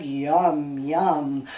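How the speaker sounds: low frog voice; low frog yum. Pretend. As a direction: rumble it like a frog. Frog eating bug.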